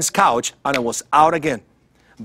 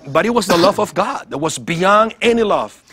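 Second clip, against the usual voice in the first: ordinary talk, nothing marked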